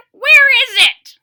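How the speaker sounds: put-on very high, loud old-man holler. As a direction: bellow it out.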